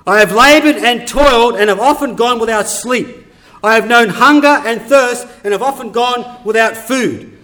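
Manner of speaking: loud, forceful preaching; impassioned scripture reading. Acted. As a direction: proclaim each hardship with heavy emphasis. Preacher reads Paul's hardships forcefully.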